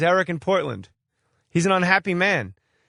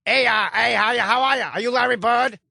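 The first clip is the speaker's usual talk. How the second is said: higher-pitched Boston street panhandler voice; Southie accent; 6 semitones higher than usual. Acted. Host mocking the caller as a Southie panhandler with a thick Boston accent. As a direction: beg for change like a homeless guy in Southie. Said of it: "You sound like a homeless guy in Southie".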